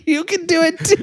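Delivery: high, sweet encouraging voice; high cheerful voice. Pretend. Voicing the goose owner.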